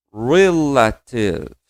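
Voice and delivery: Relatives drawn out very slowly, re-la-tives; slow pronunciation demo. Shown as a demonstration. Teacher asks class to repeat Relatives, very slow model. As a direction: stretch Relatives syllable by syllable. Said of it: "repete comigo".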